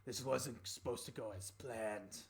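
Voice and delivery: lightly voiced defeated Dragonborn; subdued villain, a little low; doubting Dragonborn.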